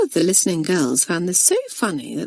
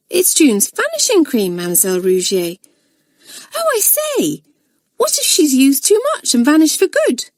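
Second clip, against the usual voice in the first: worried schoolgirl; 9 semitones higher, 3 dB louder than usual. Acted. Freddy feigns worry.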